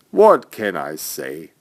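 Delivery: plain reading voice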